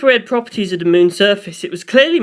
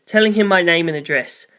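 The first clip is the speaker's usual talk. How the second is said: ordinary delivery, nothing marked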